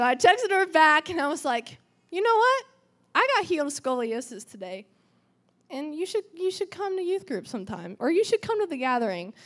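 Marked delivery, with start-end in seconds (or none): 2.1-9.3 eager invitation. Retold or imitated. Her eager text to friend.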